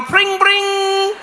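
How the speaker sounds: high, singing "pring, pring"; playful high-pitched ringing; happy bicycle bell. Acted. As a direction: imitate a cheery little bike bell. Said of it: "with the little bell".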